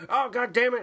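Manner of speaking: hammed-up annoyed cursing; retold anger. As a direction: act out a man getting mad. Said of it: "And he gets all mad".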